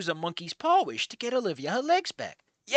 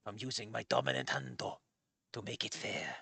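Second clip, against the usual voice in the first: low, very quiet mock-Japanese old man; fake Japanese accent; 11 semitones lower, 14 dB quieter than usual. Old man setting terms of the fight, fake Japanese accent. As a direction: murmur it in a put-on Japanese accent. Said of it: "end your fake Japanese accent on".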